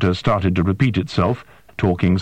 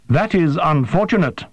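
higher, stiff and formal alien voice; alien interpreter; 8 semitones higher than usual. A narrator's reading. Interpreter questions the human, high and formal. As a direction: ask with precise, careful, unhuman politeness.

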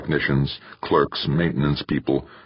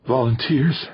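ragged with exhaustion; 9 semitones higher than usual. A narrator's reading. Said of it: "her voice ragged with exhaustion".

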